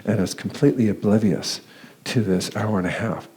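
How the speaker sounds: ordinary talk, nothing marked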